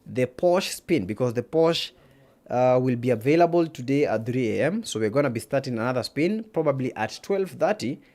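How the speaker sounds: ordinary talk, nothing marked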